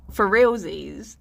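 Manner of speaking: usual speaking voice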